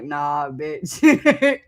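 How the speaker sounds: ordinary delivery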